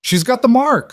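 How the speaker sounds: high, loud heckler's cry; shout from the back. A narrator's reading. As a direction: shout an accusation over a crowd. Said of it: "someone shouted from the back".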